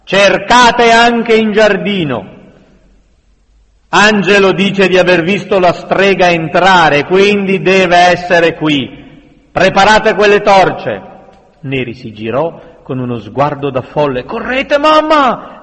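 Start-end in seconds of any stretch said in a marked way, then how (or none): 0.0-11.0 loud, raised, agitated commanding shout; bellowed orders. A narrator's reading. Mob leader hunting the witch, bellowing orders. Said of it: "sbraitò una voce alterata". 14.2-15.6 high, urgent cry; breathless panic. A narrator's reading. Neri urges mother to run, panicked. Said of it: "disse senza fiato".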